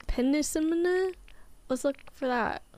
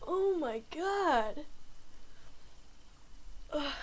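exasperated; 3 semitones higher, 8 dB quieter, slower than usual. Real, not acted. Reacting to punishment.

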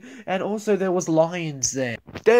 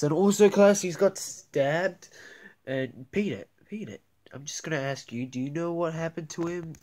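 ordinary talk, nothing marked

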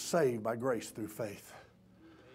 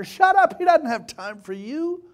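high acted crowd yell, easing off; preacher voicing a dismissive crowd; louder crying out; 13 semitones higher, 10 dB louder than usual. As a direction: start at a shout, then let it drop. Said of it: "they cried out louder and louder".

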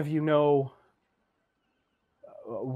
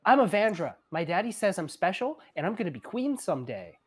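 put-on high, singsong little girl; high-pitched child character; Evandra; 6 semitones higher than usual. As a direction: introduce yourself like a proud small child. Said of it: "She kind of looks at him and goes".

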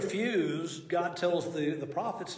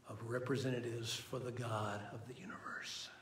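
whisper; 3 semitones lower, 9 dB quieter than usual. Real, not acted. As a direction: drop to a breathy whisper for awe. Hushed emphasis on God's envoys.

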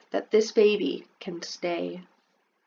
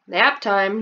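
dad announcing; 7 dB louder than usual. Acted. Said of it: "your dad will announce".

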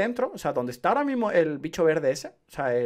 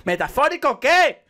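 exasperated shout; 9 semitones higher, 8 dB louder than usual. Real, not acted. Exasperated at pretentious dialogue.

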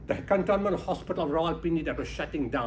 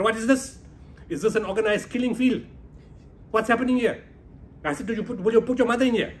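higher and louder, demanding answers; indignant outburst; 6 semitones higher, 5 dB louder than usual. Retold or imitated. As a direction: confront the general furiously. Confronting the general over the hospital.

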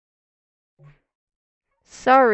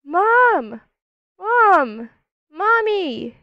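high, raised calls for mom; calling for mom; 7 semitones higher, much slower than usual. Pretend. Lost child calling out for mom, high and raised. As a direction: call out high to a mom you can't find.

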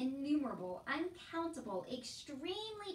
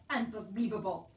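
loud, contained cry of disbelief; exasperated shout; 7 dB louder than usual. A narrator's reading. Uncle tearing his hair at the soldier, shouting. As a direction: burst out with it, disbelieving. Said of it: "he shouted".

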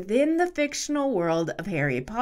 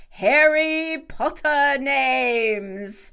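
put-on British accent; 5 semitones higher, much slower than usual. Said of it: "my sister's bad British accent".

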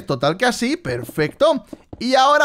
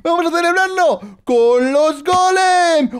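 excited shouting; 7 semitones higher than usual. Real, not acted. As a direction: shout in dismay. Celebrating then realizing mistake.

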